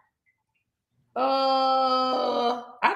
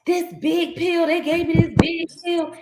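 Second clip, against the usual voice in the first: mock complaining patient; 7 semitones higher, 3 dB louder than usual. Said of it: "And she was like".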